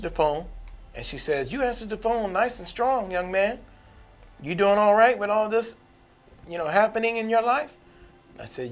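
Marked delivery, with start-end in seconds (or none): 1.5-7.8 put-on higher, lilting elderly church mother. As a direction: pitch it up like a kindly church mother. Church mother speaks on phone.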